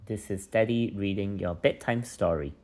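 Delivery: plain reading voice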